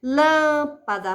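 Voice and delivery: "Lâmpada" slowly, with a strong long "Lâm"; stress on antepenultimate syllable. Shown as a demonstration. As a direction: punch and hold the start of "Lâmpada". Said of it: "a sílaba tônica é a antepenúltima". ("Lâmpada" com "Lâm" forte e alongado)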